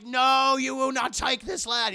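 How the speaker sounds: high, loud priestly defiance; defiant priest. Retold or imitated. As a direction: forbid the demon with full force. Priest defies the demon.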